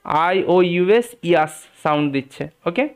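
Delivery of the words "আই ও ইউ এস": usual speaking voice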